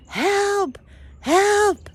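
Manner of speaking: high drawn-out call, not full volume; mock screaming. Walking lost around the woods, mock screaming. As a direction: imitate hollering for help, high. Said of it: "I'm screaming".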